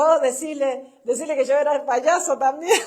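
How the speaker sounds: retelling it through laughter; laughing while saying it. Retold or imitated. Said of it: "Pero yo me reía". (riendo mientras lo dice)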